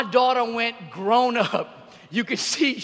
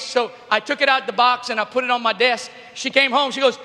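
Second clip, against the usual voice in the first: ordinary talk, nothing marked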